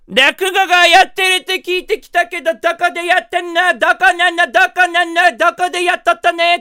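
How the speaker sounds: high, loud Kumamoto granny; old farming granny. Retold or imitated. Granny asks receptionist where rakugo is, loud old-woman voice. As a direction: badger loudly like a hard-of-hearing old woman. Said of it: "農業されてるおばあちゃんらしい人が来て".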